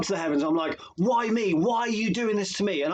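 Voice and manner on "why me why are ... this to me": raised, pitched-up mock yell at the sky; retold shout at God. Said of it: "shouting and swearing at God".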